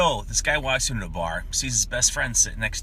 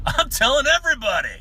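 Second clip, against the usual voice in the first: high, loud holler; gleeful boasting shout; 9 dB louder than usual. Acted. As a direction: belt it out as a triumphant punchline. Old man boasts in joke punchline.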